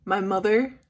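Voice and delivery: ordinary delivery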